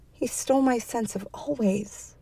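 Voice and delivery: high, soft, small lament; forlorn thought. A narrator's reading. Woman thinking of her husband, forlorn. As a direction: mourn it quietly, pitch lifted.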